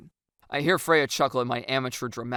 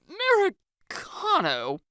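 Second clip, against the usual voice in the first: nervous gulp; 4 dB louder, much slower than usual. Acted.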